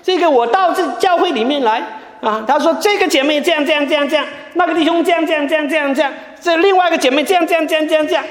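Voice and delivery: lightly voiced aggrieved sister, pitched high; voicing an upset sister airing complaints to the pastor, high and fretful; complaining church sister.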